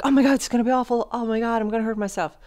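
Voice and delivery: high, fast panicked voice; frantic worry. Retold or imitated. Imitating anxious thoughts before working out. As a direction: fret in a rush about getting hurt. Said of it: "You're like".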